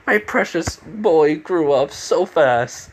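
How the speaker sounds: hammed-up proud parent; proud father. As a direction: gush slowly like a proud father. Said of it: "like, a proud father".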